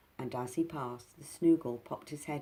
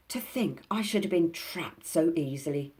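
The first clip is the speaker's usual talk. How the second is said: fury; 4 semitones higher, 7 dB louder than usual. Acted. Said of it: "said Binky in a fury".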